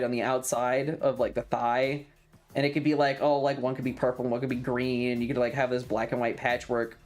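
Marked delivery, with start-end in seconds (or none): none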